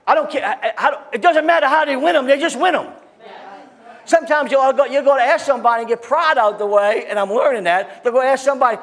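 high, loud fired-up preaching; full-force preaching. Acted. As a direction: preach it hard and loud to the congregation. Preaching builds again.

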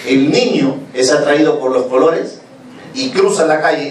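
ordinary talk

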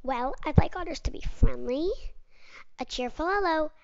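ordinary delivery, nothing marked

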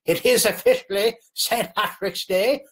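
lilting, badly faked Irish accent. Host marking Saint Patrick's Day, bad Irish accent. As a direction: put on a hammy Irish brogue. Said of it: "There's my bad Irish accent".

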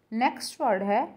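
normal talking voice

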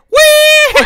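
high squealing whee; gleeful whee. Real, not acted. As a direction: squeal with delight. Thrown himself off the moon.

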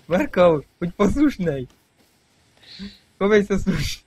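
play-acting telling off a naughty kid; mock scolding parent. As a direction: scold it like a fed-up mum. Mock scolding his game character.

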